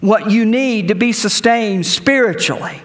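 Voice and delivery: high, slow, emphatic preaching; fiery preaching. Real, not acted. Pastor preaching hard to the congregation, emphatic. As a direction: preach it out with full force.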